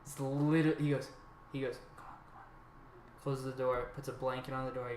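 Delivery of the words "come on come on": slow, barely audible whisper; retold whisper. Dealer ushering boys upstairs past sleeping kid, whispered.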